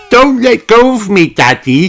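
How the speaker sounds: put-on high, loud anxious little girl; pleading with Daddy not to let go; nervous. A narrator's reading. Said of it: "says Peppa".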